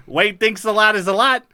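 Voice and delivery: put-on light Minnesota accent summary read. Podcaster reading the Fargo recap in a light Minnesota accent. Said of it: "as I'm reading in this accent".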